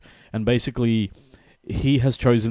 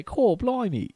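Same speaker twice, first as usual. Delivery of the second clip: much higher, hammed-up Cockney British accent; mock British slang; 13 semitones higher, slower than usual. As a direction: squeak out a cartoon British accent. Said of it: "I'm surprised he didn't say".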